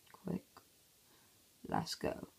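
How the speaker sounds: normal talking voice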